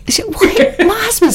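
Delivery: high-pitched laughing disbelief, then words through laughter; laughing surprise. Real, not acted. To co-host who asked if [name] is Welsh, laughing surprise. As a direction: burst out laughing, half-surprised. Amused at being called Welsh.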